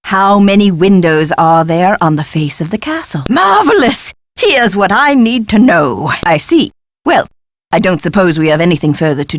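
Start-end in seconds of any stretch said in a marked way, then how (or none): 3.3-4.1 high, pitched-up burst of delight; delighted exclamation. Acted. Eccentric professor greeting good news, delighted.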